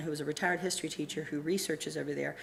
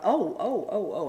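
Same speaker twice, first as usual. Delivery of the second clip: startled reaction; 3 semitones higher, 4 dB louder, slower than usual. Retold or imitated. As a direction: act out mounting astonishment. Retelling her startled reaction at the computer.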